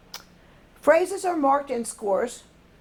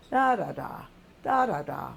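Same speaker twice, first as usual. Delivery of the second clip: "da, da, da" as a tune stepping downward; falling pitch demo; 5 semitones lower than usual. Shown as a demonstration. Teacher showing that pitch goes down at sentence end, falling pitch demo. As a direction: chant each "da, da, da" with the last one sinking low. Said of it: "that pitch goes down at the end".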